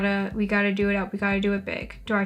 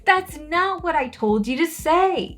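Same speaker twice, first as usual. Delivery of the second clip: higher, exasperated telling-off; mock scolding; 5 semitones higher, 4 dB louder than usual. Scolding her game character for going off script, mock exasperation. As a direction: scold in playful outrage, punching the quoted words louder.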